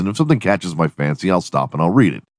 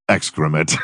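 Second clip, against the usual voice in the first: flat, low, serious one word; deadpan verdict; 3 semitones lower than usual. Pretend. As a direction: kill her joy with a straight face. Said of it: "You say it like you're very serious".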